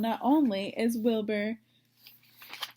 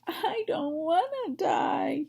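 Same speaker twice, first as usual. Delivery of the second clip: moaning; 6 semitones higher, slower than usual. Acted. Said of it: "he moaned".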